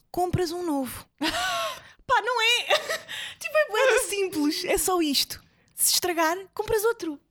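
high giggly laughter; laughing. Real, not acted. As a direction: laugh through the words. Laughing at own joke.

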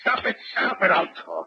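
high, strained plea fading to a whisper; giving in under the blows; desperate, breathless surrender; breaking down. Acted. Perdue slapped into confessing.

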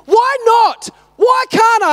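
high-pitched mock tantrum; imitated tantrum yell. Six-year-old son having a tantrum at dad, imitated yelling. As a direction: yell it like a kid stamping his foot. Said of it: "He's having a tantrum. He's yelling".

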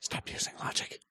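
mock-scolding whisper. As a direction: whisper it sternly. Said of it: "Why are we whispering?".

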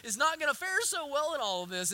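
ordinary talk

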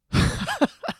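high, quick burst of laughter; short laugh. Real, not acted. Comic cracking up at own story, short high laugh.

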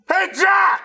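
sudden high, loud shout; Jeff. Retold or imitated. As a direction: blast the greeting as the video starts. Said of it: "Jeff's like".

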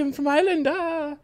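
very high, silly Irish voice; put-on Irish accent. Introducing himself as from Ireland, high hammed-up Irish. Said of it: "why are you saying it in that voice".

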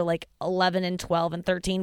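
usual speaking voice

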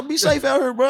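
high, eager farewell; friendly send-off. Retold or imitated. Robber says goodbye, friendly.

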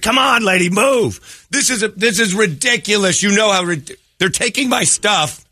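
high hammed-up impression of the angry line; mocking the crowd. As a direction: pitch up high to ham up the crowd and lady. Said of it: "Everybody's like".

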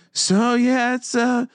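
high, halting nervous voice; nervous assistant GM. Retold or imitated. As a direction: mimic an anxious, fumbling boss. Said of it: "looked like Bill Simmons, and he was so nervous".

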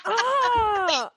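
a long, high scream of giggly horror; high laughing scream. Real, not acted. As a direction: let out a mock-horrified scream. Reacting to embarrassing episode title.

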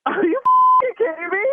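stunned, slowed-down disbelief; can't believe what she just heard; shocked disbelief. Real, not acted. Prank revealed, shocked reaction.

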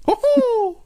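very high squeaky ho-ho; mock Mickey laugh.

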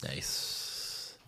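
breathy whispered nice. Real, not acted. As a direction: whisper it approvingly. Repeated reply, whispered.

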